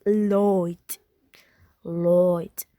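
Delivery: "Lloyd" for light, oy vowel with final t; Irish light. Shown as a demonstration. Teacher contrasting accents, Irish light. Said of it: "In Irish is".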